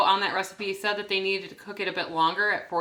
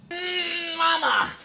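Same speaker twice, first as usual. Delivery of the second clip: calling out; 8 semitones higher, much slower than usual. Real, not acted. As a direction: call out to mom across the house. Calling out to mother.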